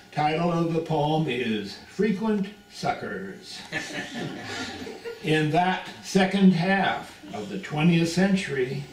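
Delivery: normal talking voice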